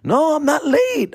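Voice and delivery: high, slow, drawn-out protest; indignant denial. Retold or imitated. Driver answering the cop about being late, indignant denial. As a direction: reenact an exaggerated high-pitched denial.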